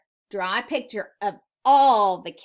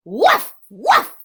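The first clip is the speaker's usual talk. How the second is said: high, very loud woofs; loud dog bark; 7 semitones higher, 13 dB louder than usual. Acted. Teacher reads the noisy dog to children, loud high barking. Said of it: "went the dog".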